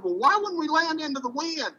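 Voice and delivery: high-pitched, incredulous retold question; retold incredulous challenge. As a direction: replay questioning the pilot, pitch well up.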